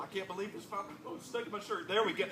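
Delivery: usual speaking voice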